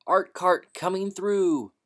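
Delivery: loud, slow, clear call. A narrator's reading. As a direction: call ahead to clear the hall. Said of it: "yelling in a loud, clear voice".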